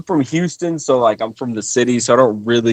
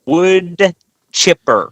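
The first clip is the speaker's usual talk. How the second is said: "Wood chipper" drawn out slowly in a Wisconsin accent, higher; 6 semitones higher, 4 dB louder, much slower than usual. Shown as a demonstration. Guest saying wood chipper on request, slow Wisconsin accent. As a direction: stretch each word of "Wood chipper", Wisconsin style. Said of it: "Sounds pretty Wisconsin to me".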